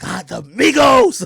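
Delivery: high, drawn-out gleeful cheer; excited fan. Retold or imitated. Host not paying attention, just excited at the Migos. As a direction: cheer it, oblivious and thrilled. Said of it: "I'm excited".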